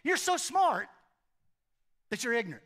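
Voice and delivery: high, loud mock exasperation; Jesus needling Nicodemus, sarcastic and loud. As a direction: tease him for being too clever. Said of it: "he's being sarcastic with Nick".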